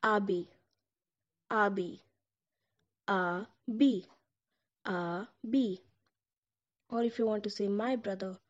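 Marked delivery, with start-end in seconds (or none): 0.0-5.8 "Abi" repeated clearly; Turkish abi. Shown as a demonstration. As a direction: say "Abi" as ah-bee. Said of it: "you can just call him".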